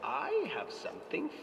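high, slow sugary crone; witch voice. Acted. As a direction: coax them in like a witch.

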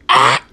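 very loud, short "ah"; sudden yell as if struck; pretend pain yell. Character who just got hit, pretend pain yell.

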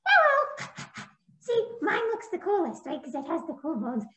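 very high, boastful puppet voice; very high bragging puppet; bragging puppy puppet. Acted. Puppy brags again.

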